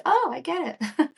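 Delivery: high, bright imitation of others catching on; lightly mimicking people who finally get it; mock realization. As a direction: pitch it up like a sudden penny drop. People voiced understanding.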